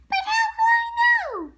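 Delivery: put-on high loud boy's question; high-pitched puppet boy shouting; Pinocchio. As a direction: ask high and eager. Pinocchio asks fairy.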